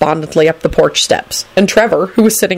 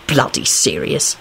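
low, slow, mildly angry grumble; reply with a touch of anger; 3 semitones lower, 5 dB quieter, slower than usual. A narrator's reading. Lupin answering Neville, mildly angry. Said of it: "he replied angrily".

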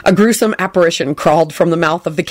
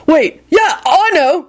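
high, eager burst; excited; 10 semitones higher, 3 dB louder, slower than usual. Acted. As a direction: jump in with an idea. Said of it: "Ron said excitedly".

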